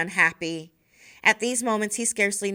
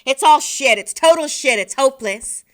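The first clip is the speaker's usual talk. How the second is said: loud, despairing yell; mother yelling after throwing the coffee cup; 5 semitones higher, 9 dB louder than usual. Acted. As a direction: hurl it out in despair. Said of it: "start yelling".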